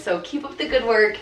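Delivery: ordinary delivery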